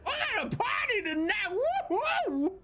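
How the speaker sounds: very high, fast squeaky party whoop; a high cartoon voice celebrating; excited horse party voice. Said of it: "and he's like".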